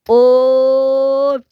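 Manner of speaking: long, high, loud held "oh"; pretend outcry. Dismayed in pretend play, high sustained cry.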